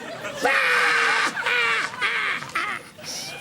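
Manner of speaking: high loud shout of laughter; shrieking laugh. Real, not acted. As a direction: let the laugh explode. Hypnotist bursts out laughing.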